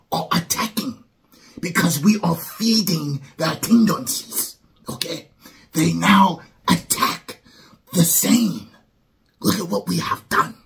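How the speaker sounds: high-pitched mad scientist voice; ranting like a crazed quack doctor. Pretend. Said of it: "I was trying to sound like a, a mad scientist, quack doctor".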